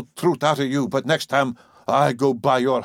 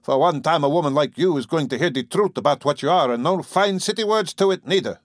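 loud, higher angry sneer; 6 semitones higher, 4 dB louder than usual. A narrator's reading. Farmer sneering at the city woman, loud and angry. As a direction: spit out the scorn for city women. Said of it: "and sneered".

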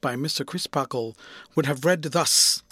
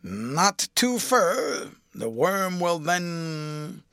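slow, drawn-out, rustic grumble; chafed driver; 2 semitones higher, much slower than usual. A narrator's reading. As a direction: warn him slowly, losing patience. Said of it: "becoming more chaffed in temper".

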